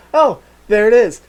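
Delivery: put-on high, loud childlike wonder; giddy awe. Imagining touching the passing asteroid, high and giddy. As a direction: reach for the asteroid like a delighted kid.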